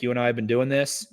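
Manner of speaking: ordinary talk, nothing marked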